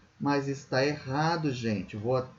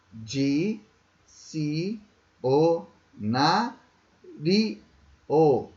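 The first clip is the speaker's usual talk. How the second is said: Di-ci-o-na-ri-o very slowly, a pause after each syllable; Di-ci-o-na-ri-o chopped into slow, separate beats; much slower than usual. Shown as a demonstration. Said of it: "Quantas sílabas são?".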